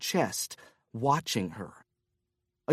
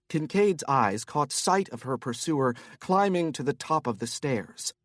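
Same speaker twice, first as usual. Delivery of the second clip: ordinary talk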